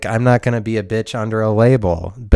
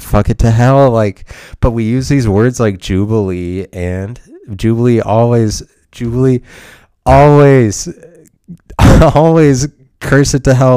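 higher and louder, cursing with a laugh breaking through; half-laughing angry outburst; 4 semitones higher, 5 dB louder than usual. Real, not acted. Vlogger curses the word jubilee to viewers, loud half-laughing outburst. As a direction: blow up at a silly word, half joking.

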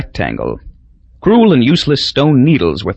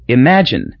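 usual speaking voice

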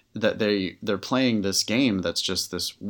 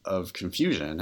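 ordinary talk

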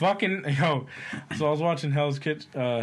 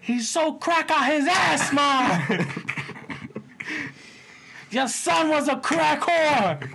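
mock whiny son; 13 semitones higher, slower than usual. Son complaining to mom about his brother, high slow rant. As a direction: ham up the son ranting to ma.

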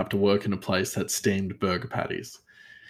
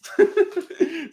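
high laugh. Real, not acted. Laughing at own joke.

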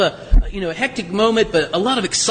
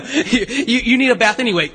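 ordinary talk

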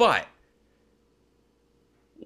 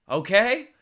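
usual speaking voice

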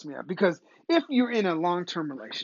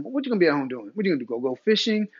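ordinary delivery

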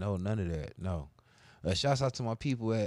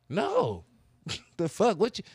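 raised, loud mock indignation; indignant refusal; 8 semitones higher, 5 dB louder, slower than usual. Man retelling his answer to his girlfriend, indignant. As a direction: refuse high and loud, as if affronted.

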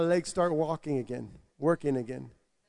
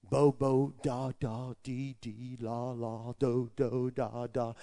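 made-up tongues syllables; 5 semitones lower, 6 dB quieter, slower than usual. Retold or imitated. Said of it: "began to make up words".

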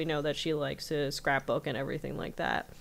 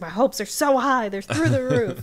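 higher, louder, swooning with hope; giddy, lovestruck cartoon girl voice; gushing Mabel; 7 semitones higher, 7 dB louder than usual. Mabel gushing over Mermando.

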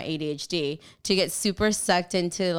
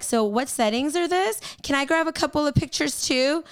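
eager mock question; 8 semitones higher than usual. Mimicking pushy assistant.

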